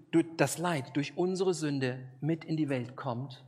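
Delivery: ordinary delivery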